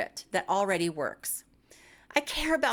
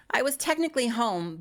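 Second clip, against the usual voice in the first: usual speaking voice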